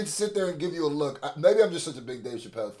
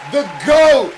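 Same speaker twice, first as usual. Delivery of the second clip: loud and higher, hyped up; excited shout; 9 semitones higher, 14 dB louder than usual. Real, not acted. Host hyping up Chappelle to his viewers, excited loud shout. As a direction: shout the praise like a fan.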